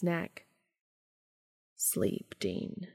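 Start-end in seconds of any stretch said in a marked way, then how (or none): 1.7-2.9 soft goodnight whisper. Acted. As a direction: whisper him to sleep.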